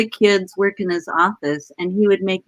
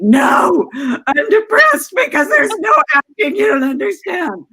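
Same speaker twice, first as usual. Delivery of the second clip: high, loud hammed-up protest; turning down James, mock despairing; mock despairing outburst; 9 semitones higher, 5 dB louder than usual. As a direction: throw a dramatic fit. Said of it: "I was like".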